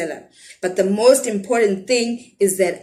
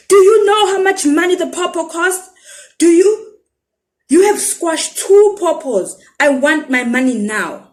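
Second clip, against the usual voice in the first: high, loud, furious market seller; angry shout; 9 semitones higher, 6 dB louder than usual. Acted. Pawpaw seller shouting at the boys, furious. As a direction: yell at the small boy over squashed pawpaws. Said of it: "leans over and shouts".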